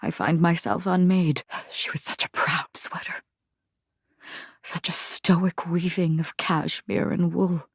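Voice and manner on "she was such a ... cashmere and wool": whisper. Acted. Whispered aside.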